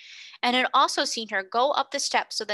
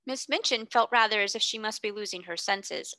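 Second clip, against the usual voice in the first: plain reading voice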